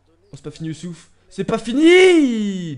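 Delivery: builds to a long, drawn-out loud shout; excited shouting. Real, not acted. Gamer urging Youssouf on, rising to a shout. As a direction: roar the last "fini" as the chance lives on. Chance missed, play goes on.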